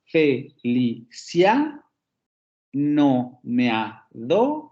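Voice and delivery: "Felicia, no me ha do" chanted slowly, CIA and DO rising; very slow metrical demo, "fe-li-CIA no-me-a-DO"; stress on third and sixth syllables. Shown as a demonstration. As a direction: scan it syllable by syllable, lifting CIA and DO. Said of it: "en la tercera sílaba aparece el acento".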